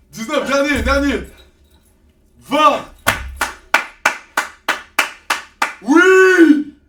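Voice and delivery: loud hype coach, building to final rep; shouting encouragement. Real, not acted.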